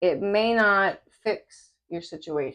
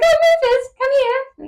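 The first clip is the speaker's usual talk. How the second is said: very high, loud British mum; imitated English mum accent; 6 dB louder than usual. English mum calling little son at pool, imitated British accent. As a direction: call him over in piercing British. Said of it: "the mom was like".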